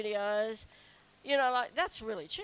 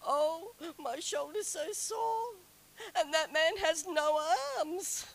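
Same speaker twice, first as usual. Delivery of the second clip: shocked whimper; 9 semitones higher, 7 dB louder than usual. Retold or imitated. Woman at Disneyland shocked beside an armless man, high and tearful. Retold self-talk, shocked whine.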